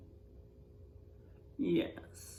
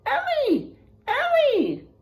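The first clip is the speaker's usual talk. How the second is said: calling out; 13 semitones higher, 7 dB louder, slower than usual. Acted. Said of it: "I cried".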